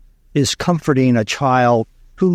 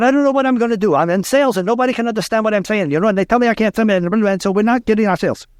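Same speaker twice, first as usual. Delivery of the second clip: put-on Spanish accent; 9 semitones higher, faster than usual. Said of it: "he has a very heavy Spanish accent".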